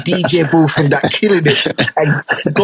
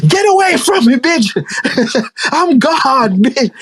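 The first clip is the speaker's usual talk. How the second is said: put-on drunk belligerent God; 10 semitones higher, slower than usual. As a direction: screech it at her like a furious drunk. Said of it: "He drunk and belligerent".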